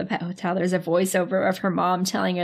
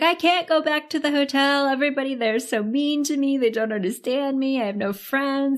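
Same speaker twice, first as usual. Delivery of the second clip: higher-pitched, put-on poor-me plea; mock sob story; 5 semitones higher, 3 dB louder than usual. Cat guilting the woman into keeping him, mock sob story. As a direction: lay on the self-pity as a put-upon cat. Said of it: "gives her the sob story".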